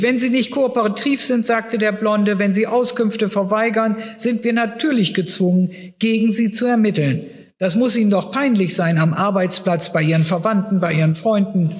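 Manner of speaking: a smug cop leaning on her; menacing policeman. Acted. Reads the Blonde's threat. (drohender Polizist, höher gesprochen)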